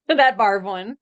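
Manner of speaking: ordinary talk, nothing marked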